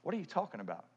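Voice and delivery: soft, puzzled mock reply; lightly voiced confused followers; confused disciples. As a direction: play the baffled disciples, hushed. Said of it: "they're looking around like".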